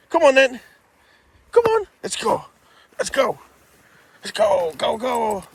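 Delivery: high, excited calls to the dog; calling a dog. Real, not acted. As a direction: urge the dog along, squeaky and eager.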